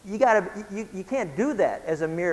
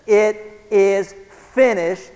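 IT IS FINISHED, very slow and louder, each word weighted; emphatic declaration; 3 semitones higher, 6 dB louder, much slower than usual. Retold or imitated. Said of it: "he meant it".

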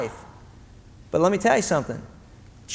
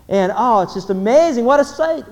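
marvelling; 7 semitones higher, 6 dB louder than usual. Acted. Narrator marvels at the scene.

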